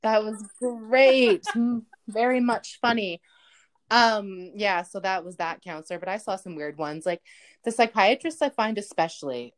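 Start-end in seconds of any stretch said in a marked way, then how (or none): 0.0-3.2 very slow, drawn-out sarcastic praise; mock praise. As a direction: stretch out the insincere compliment. Deadpan reply to the failed joke.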